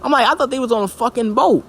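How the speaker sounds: high, baffled reaction; shocked confusion. Retold or imitated. Telling viewers his first read, high baffled shock. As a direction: squeal out the confusion. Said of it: "I was like".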